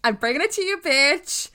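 high, hammed-up hype; hyped-up excitement. Co-host playing up excitement for listeners, hammed-up hype. Acts the game-show excitement just described.

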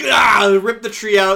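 loud straining shout as he heaves; effort yell. Acted. Ripping tree from ground.

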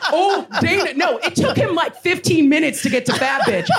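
ordinary talk, nothing marked

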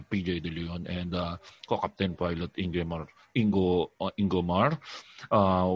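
low, slow captain's cabin announcement; pilot announcement. Retold or imitated. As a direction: do the pilot over the intercom. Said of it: "Di ba parang gano'n?".

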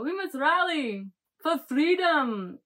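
slow, high, rousing mock-Scottish cry; rally them in hammed-up Scottish; exaggerated Scottish accent. Leader on podium rallying crowds, exaggerated Scottish accent. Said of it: "this accent that I've been working on".

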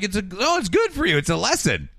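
high-pitched mock reassurance; patronizing. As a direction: brush it off in a high, sing-song voice. Teacher brushing off her complaint.

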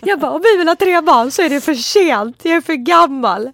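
put-on loud, high, wailing drunk sob-talk; high loud tipsy wailing, the voice cracking into tears; drunk crying. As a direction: wail it like a tipsy crying fit. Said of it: "fyllegråt".

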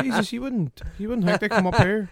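surprised. Acted. Acting out finding things up in the attic, surprised. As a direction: exclaim it like a startled discovery. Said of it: "you're just all".

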